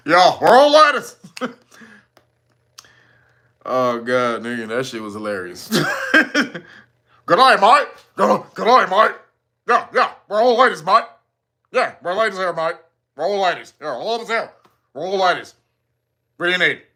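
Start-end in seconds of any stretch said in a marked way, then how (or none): 0.1-1.2 hammed-up mock Australian; broad, comic Australian impersonation; deep Australian accent. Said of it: "had a deep, deep Austra". 6.1-16.8 broad mock Australian; comic Australian imitation; badly faked Australian accent. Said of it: "I can't do the Australian".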